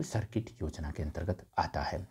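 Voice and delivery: whispered aside, as if sharing a secret tip. Real, not acted. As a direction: drop to a whisper for the key point. Teacher stresses a key fact. (फुसफुसाते हुए ज़ोर देकर)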